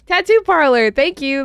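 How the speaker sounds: loud, high burst of recognition; excited. Real, not acted. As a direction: blurt it out, thrilled. Remembers the channel name.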